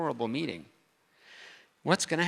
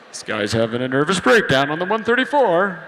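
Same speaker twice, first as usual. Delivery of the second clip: worried driver; 10 dB louder than usual. Retold or imitated. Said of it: "somebody in the car next to me".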